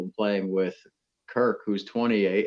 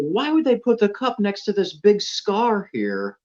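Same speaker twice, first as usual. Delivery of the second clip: much higher, slower, baffled question; puzzled surprise; 11 semitones higher, 5 dB louder, slower than usual. Retold or imitated. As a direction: act baffled at the scarred green. Golfer puzzled at damaged green.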